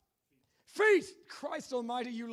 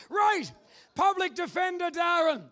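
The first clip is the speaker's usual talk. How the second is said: ordinary delivery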